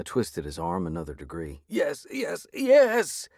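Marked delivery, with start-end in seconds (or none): 1.7-3.3 very high, panicked yes; panting tearfully. A narrator's reading. Thug gives in to the hero, panting tearfully. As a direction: gasp it out in tears. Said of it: "he panted tearfully".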